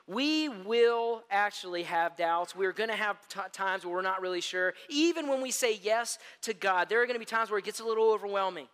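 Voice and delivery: ordinary delivery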